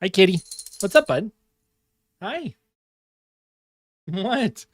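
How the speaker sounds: high cooing to the cat; pet talk. Real, not acted. Talking to his cat.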